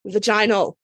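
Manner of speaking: Vaginal as va-JY-nal; stress on second syllable. Shown as a demonstration. Host correcting co-hosts' pronunciation, Vaginal as va-JY-nal. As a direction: say Vaginal with a long "jy" in the middle. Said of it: "don't say vagina and vaginal properly".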